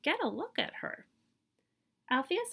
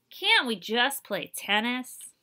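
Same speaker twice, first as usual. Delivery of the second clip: whiny protest; 3 semitones higher, 6 dB louder, slower than usual. Acted. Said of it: "Althea muttered".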